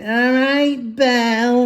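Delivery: high, bright sing-song greeting, imitating the mum; mock friendly gossip. Dad imitating the gossiping mum patting his daughter, mock sweet. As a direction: ape her fake-sweet hello. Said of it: "and she went".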